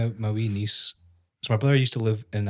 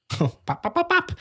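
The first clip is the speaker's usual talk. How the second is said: high squeaky words broken by laughter; giggling mock clown pops; laughing. Real, not acted. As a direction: laugh your way through the pops. Laughing through a Pennywise line.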